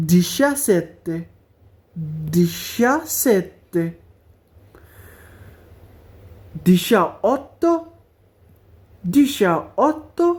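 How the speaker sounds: "diciassette" and "diciotto" drawn out very slowly; slow right down on "diciassette" and "diciotto"; very slow drill. Shown as a demonstration. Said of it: "যাতে উচ্চারণগুলো ভালো মতো খেয়াল থাকে".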